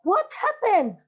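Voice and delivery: high, loud little bird voice; a bird's high call of alarm. Acted. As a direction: squeak it out like a startled bird. Bird asks in story.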